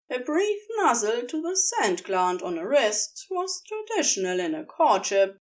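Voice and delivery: usual speaking voice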